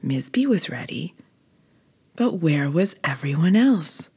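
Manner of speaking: ordinary delivery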